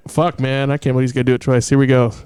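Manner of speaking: ordinary talk, nothing marked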